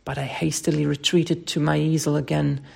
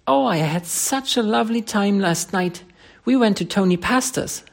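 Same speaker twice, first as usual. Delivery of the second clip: put-on higher, gushing young model; chattering; 5 semitones higher than usual. Tessie tells the painter about last night, chatty and higher. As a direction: chatter away happily. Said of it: "she began to chatter".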